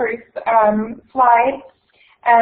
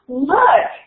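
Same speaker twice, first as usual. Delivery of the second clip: higher, louder sudden cry; startled cry; 6 semitones higher, 3 dB louder than usual. Acted. Hermione to the boys, spotting the wall, startled cry. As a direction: point and call out.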